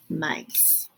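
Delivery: hushed, small reply; whispered answer. Acted. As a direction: barely breathe the word.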